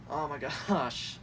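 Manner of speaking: usual speaking voice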